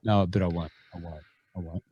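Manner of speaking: ordinary talk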